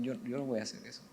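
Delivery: soft, quick, unassuming refusal; without raising the voice. Acted. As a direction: say it quietly, no show at all. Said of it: "sin levantar la voz dijeron".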